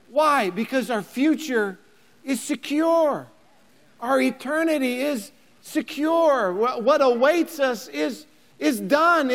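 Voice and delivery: ordinary talk, nothing marked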